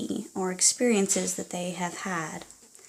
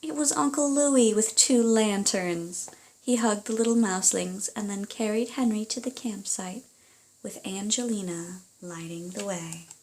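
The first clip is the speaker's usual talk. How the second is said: lively storybook reading aloud; reading to children; 2 semitones higher, 4 dB louder than usual. Acted. Parent reading a picture book to a child, animated storytelling. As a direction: read it like a bedtime story to little ones.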